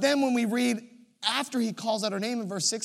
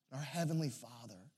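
ordinary talk

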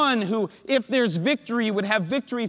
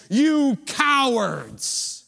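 arrogant angry giant; 4 semitones higher than usual. Acted. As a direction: bellow scorn down at the cowards. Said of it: "arrogance and anger in his voice".

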